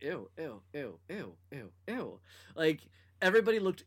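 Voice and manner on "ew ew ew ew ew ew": soft repeated disgusted ews. Retold or imitated. Disgusted reaction.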